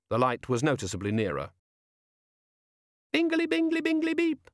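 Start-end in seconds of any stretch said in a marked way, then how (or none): 3.1-4.5 very high, tinny little chirp; sing-song gadget voice. Acted. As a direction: chirp like a tiny imp in a box. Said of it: "the little sing-song voice".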